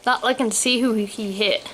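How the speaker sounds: ordinary delivery, nothing marked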